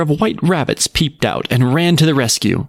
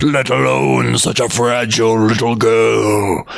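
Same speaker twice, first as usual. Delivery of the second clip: whirlwind voice. Acted. Exhausted whirlwinds talking.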